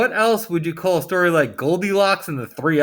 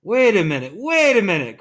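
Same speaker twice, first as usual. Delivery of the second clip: raised, urgent interruption; crying out; 5 semitones higher, 3 dB louder than usual. A narrator's reading. Narrator breaking into the hen's story, crying out. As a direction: call out to halt everything. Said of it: "cried Jack the narrator".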